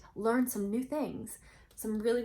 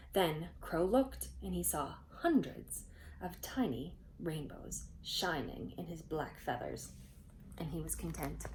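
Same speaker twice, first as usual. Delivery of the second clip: put-on storytelling voice for kids; gentle read-aloud narration; story narration; 3 semitones lower, 4 dB quieter, slower than usual. A narrator's reading. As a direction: read it like a bedtime legend. Reading a legend to children.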